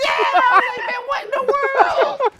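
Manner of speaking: high, loud laughing shock; laughing and high, can't believe it; laughing mock disbelief. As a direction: crack up in disbelief. Dad stunned, laughing.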